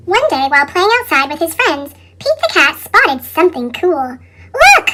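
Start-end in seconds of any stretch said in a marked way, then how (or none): 4.6-4.9 shout. Acted. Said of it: "shouted Pete".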